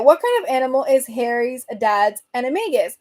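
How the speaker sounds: ordinary talk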